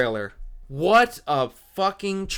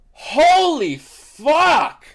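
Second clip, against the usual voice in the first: drawn-out, much higher, louder shout of awe; amazed outburst; 10 semitones higher, 7 dB louder, much slower than usual. Real, not acted. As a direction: blurt it out in real disbelief. Thrilled reaction to the trailer.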